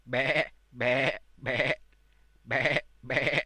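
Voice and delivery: bleating like a lamb. Pretend. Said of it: "it's a sound of a lamb".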